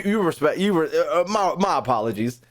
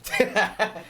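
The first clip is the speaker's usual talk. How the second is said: short real laugh; burst of laughter; 5 semitones higher than usual. Real, not acted. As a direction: crack up at the news. Laughing at a Pokemon voice role.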